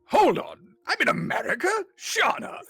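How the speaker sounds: put-on high, loud, shocked old wizard; the wizard learning he is in America, aghast; astonished wizard. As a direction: stop short in wide-eyed alarm.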